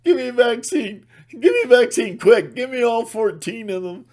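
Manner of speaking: high, loud mock panic; panicked person. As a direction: beg for the vaccine in a hammed-up panic.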